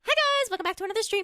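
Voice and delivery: high, rapid mock streamer greeting; influencer voice. Streamer parodying her own stream greeting, influencer voice. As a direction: rattle off a perky influencer intro, pitched high. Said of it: "I don't think I talk like that".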